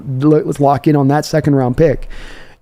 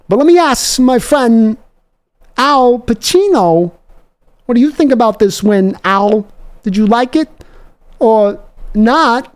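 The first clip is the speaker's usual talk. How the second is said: high, raised showman's handoff; hosting interview; 8 semitones higher, slower than usual. Acted. Host turns to his friend Al for a verdict, high showman voice. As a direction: toss it to the guest like a talk-show host. Introducing Al Pacino voice.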